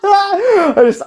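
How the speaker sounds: laughing, voice jumping up; high real laughter. Real, not acted. Fan losing it over Kaiba's reaction, laughing high. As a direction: laugh through the words.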